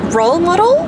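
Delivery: playful Australian "Role model?" with a rising end; Australian accent try. Shown as a demonstration. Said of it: "in an Australian accent".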